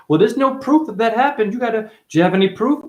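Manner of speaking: high, whiny mock challenge; mocking doubters. Acts out people demanding proof.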